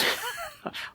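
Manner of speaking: soft breathy laugh. Real, not acted. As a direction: laugh quietly at the tease. Teased about his accents.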